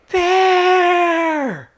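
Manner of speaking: high piercing yell; yelled There. Retold or imitated. Wife spotting a bear, high piercing yell. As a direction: shout it across the clearing. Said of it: "she yells".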